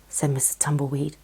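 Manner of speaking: usual speaking voice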